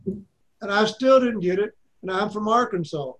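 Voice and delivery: ordinary talk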